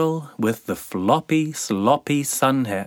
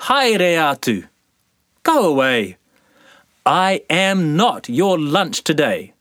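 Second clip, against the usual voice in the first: loud mock shout, higher; shouting boy; 6 semitones higher, 4 dB louder than usual. Boy shooing sandflies, loud mock shout. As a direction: shoo them off loudly. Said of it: "he shouted".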